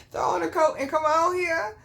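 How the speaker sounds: loud, high, insistent repeat; retelling an impatient yell to hurry up; exasperated urging. Retold or imitated. Daughter urges mama to come downstairs, exasperated. Hurrying mother along, retold.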